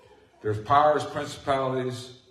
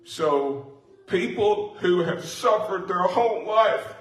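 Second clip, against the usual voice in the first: getting excited; 6 semitones higher than usual. Real, not acted. Preacher to the congregation about healings, getting excited. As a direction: let the excitement run away with you. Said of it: "I get a little excited when I talk about it".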